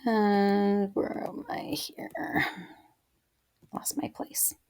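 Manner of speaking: hushed whisper, slow, searching the page; whispering to herself. Real, not acted. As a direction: whisper it as you hunt for your place. Teacher loses her place while reading.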